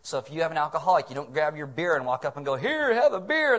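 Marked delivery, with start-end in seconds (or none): 2.5-3.6 high, loud, drawn-out offer; pushy friendly offer. Acted. Drinker thrusting a beer at an alcoholic, loud and drawn out. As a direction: call it out slowly, high and loud.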